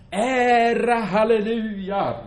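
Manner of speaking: loud, high cry of praise; shouted praise. Retold or imitated. David crying out his praise to God, loud. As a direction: shout hallelujah out. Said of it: "ropar han bara".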